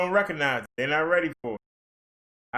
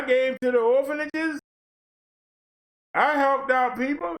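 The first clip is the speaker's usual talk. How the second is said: much higher, whiny protesting voice; pleading rejected preacher; 8 semitones higher than usual. Acted. Rejected preacher protesting to Jesus, high and pleading. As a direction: protest your innocence, high and aggrieved.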